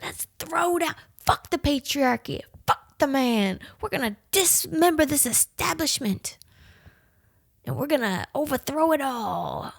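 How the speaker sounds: high, fired-up rally voice; mock young activist. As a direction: play a riled-up kid activist. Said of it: "So I grew up to be like".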